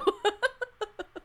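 laughs high and bright at her own impression; cracking up at the mockery; high burst of laughter. Real, not acted. Laughing after mock quote.

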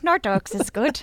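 slow, hammed-up Irish accent; a deliberately awful Irish brogue; bad Irish accent. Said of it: "That was the worst Irish accent".

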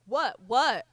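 loud, punchy mock challenge; aggressive challenge. Neighbour storming onto the lawn to confront her, aggressive challenge.

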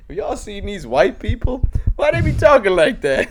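high-pitched, incredulous stand-up bit; stand-up comic impression. Comics spoofing old routines, high stand-up voice. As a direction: push it way up high, mock bafflement.

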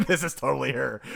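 amused, through a laugh; chuckling certainty. Real, not acted. Laughing at own guess.

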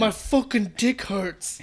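slow, drawn-out mock English accent; attempted English accent. Co-host trying the patient's line, bad English accent. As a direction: badly fake an English accent, stretching the words. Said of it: "I can't do it either".

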